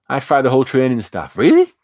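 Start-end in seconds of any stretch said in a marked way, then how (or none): 1.4-1.7 a sudden, much higher, louder "really?"; startled, incredulous query; shocked disbelief. Real, not acted. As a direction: jump up in real surprise at the news. Reacting to chat's surprising claim.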